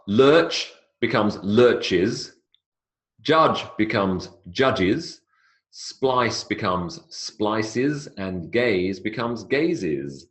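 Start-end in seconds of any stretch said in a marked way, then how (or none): 3.2-10.2 slow, "judges", "splices", "gazes" with a clear iz ending; slow teaching demo. Shown as a demonstration. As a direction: draw out the extra syllable. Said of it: "the is sound on the end".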